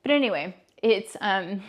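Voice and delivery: normal talking voice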